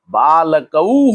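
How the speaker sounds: बालकऊ very slowly, "baa-la-ka-oo" with a long ending; drawn-out dual ending. Shown as a demonstration. Teacher showing how two boys changes बालकऊ, drawn-out ending. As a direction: stretch the final "oo" of बालकऊ. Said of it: "तो क्या हो गया?".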